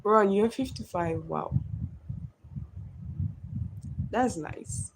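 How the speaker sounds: ordinary delivery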